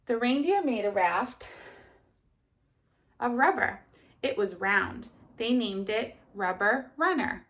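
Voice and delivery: put-on lively storybook reading to children; kindergarten teacher voice. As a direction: read it brightly to little kids. Teacher reads story aloud.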